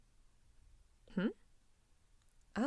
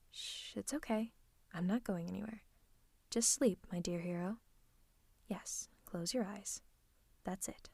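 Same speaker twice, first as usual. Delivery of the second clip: soothing hush; 10 dB quieter than usual. Acted. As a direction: hush them gently to sleep. Soothing hero to sleep.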